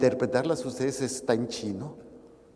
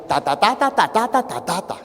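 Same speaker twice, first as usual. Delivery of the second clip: higher, louder babble of rattled-off syllables; unintelligible little boy; 4 semitones higher, 5 dB louder than usual. Retold or imitated. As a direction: imitate a small boy's gibberish answer. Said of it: "levantaba la mano el niño y decía".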